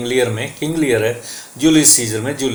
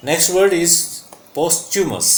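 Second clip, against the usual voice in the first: ordinary delivery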